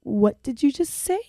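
softly incredulous, drawing out the question; stunned disbelief. Retold or imitated. Woman retelling her reply to a coworker's uncanny message, stunned disbelief.

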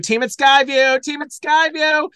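high, loud repeated cheer; mock award shout-out. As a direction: whoop it like an award winner.

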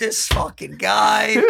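slow, dragged-out disbelief; unimpressed slow drawl imitation; exasperated drawl. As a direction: stretch every word like you're fed up. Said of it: "He's like".

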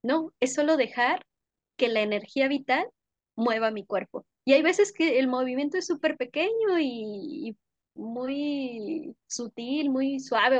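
ordinary talk

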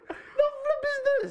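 very high, loud laughing disbelief; incredulous outburst. Retold or imitated. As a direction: squeal in hammed-up bafflement, laughing. Retelling his stunned reaction to accent.

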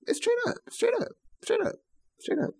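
high mock falsetto agreeing; squeaky comic repeat. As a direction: squeak it out like a joke.

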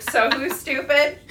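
teasing gotcha, slowed down; smug retort. Retold or imitated. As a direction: rub it in, drawn out. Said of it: "retort to mom".

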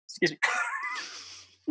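stunned by the taste; shocked gasp. Real, not acted. Gasps at first bite.

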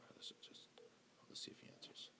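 hushed whisper while waiting. Real, not acted. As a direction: keep it to a whisper. Whispering while the phone rings.

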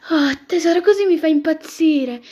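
loud, high swoon; gushing. Acted. Fiancée answers her lover's compliment, gushing. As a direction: gush it loudly, drawing out the words.

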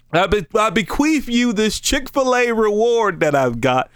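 jokingly bestow it like a treasured heirloom; hammed-up formal giving; mock-grand bequest. Joke bequeathing a reward.

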